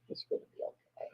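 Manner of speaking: soft whispered comfort to a pet; whispering. Real, not acted. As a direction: breathe the words gently. Soothing the cat, whispered.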